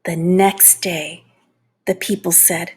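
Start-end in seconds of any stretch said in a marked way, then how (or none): none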